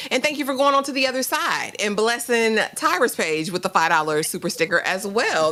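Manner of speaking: usual speaking voice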